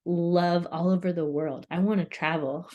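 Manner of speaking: ordinary delivery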